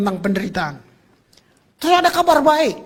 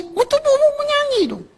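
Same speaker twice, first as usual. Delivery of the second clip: much higher pressing call to sing; excited urging. Acted. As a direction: lift the crowd to sing with their bodies. Preacher urges singing with body. (seruan bersemangat)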